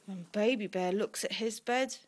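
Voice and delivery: ordinary delivery, nothing marked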